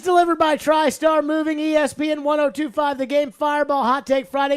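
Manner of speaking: loud, high announcer delivery; radio show opener. Acted. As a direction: open the show with full announcer punch.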